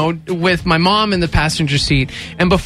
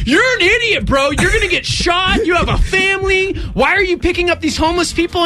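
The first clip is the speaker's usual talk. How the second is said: higher, shouting at a friend, fast; mock exasperated yell; 10 semitones higher than usual. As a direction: yell at him like he's crazy. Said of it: "I'm like".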